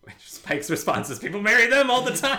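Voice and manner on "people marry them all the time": glib retort. Retold or imitated. Spike's glib reply, quoted.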